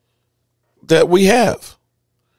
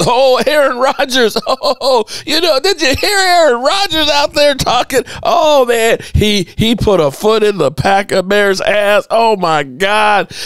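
mock TV host gushing; 11 semitones higher than usual. Radio host mocking how the shows fawned over Aaron Rodgers. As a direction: gush and laugh like a fawning pundit. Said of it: "the shows".

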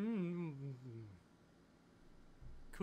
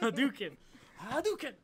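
higher shouted Hadouken; shouted special move; 5 semitones higher, 6 dB louder than usual. Acted. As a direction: yell Hadouken like a game fighter. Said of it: "you just gotta".

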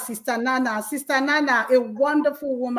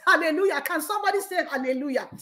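ordinary talk

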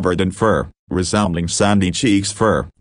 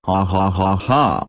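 villainous laugh. Acted. Plankton cackling over his scheme, villainous laugh. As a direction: cackle like a cartoon villain plotting.

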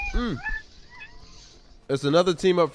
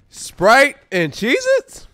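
slow drawn-out disbelief, loud and high; incredulous; 8 semitones higher, 7 dB louder, much slower than usual. Real, not acted. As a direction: stretch it out in disbelief. Mocking a weird food combo.

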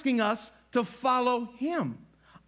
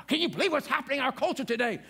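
fast, higher mock outrage; grumbling news watcher; 4 semitones higher, faster than usual. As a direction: rant like a cranky news addict. Acting an angry news watcher.

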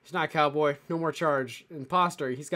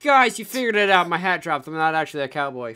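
loud, playful outburst; hammed-up loud admission; mock confession; 8 dB louder than usual. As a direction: announce it like you've been caught. Mock confession of fake cowboy.

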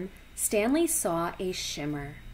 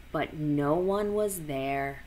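usual speaking voice

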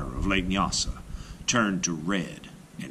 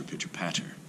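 fading whisper; 2 semitones lower, 9 dB quieter than usual. A narrator's reading. Footsteps fading away.

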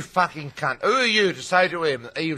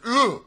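high, sharp grunt of pain; 10 semitones higher, 4 dB louder than usual. Retold or imitated. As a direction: grunt like you've been kicked. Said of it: "he said".

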